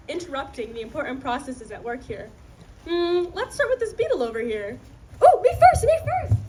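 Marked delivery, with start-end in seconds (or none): 5.2-6.4 high, loud eager squeal; excited. Acted. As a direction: beg to be first, eager. Beetle eager to go first.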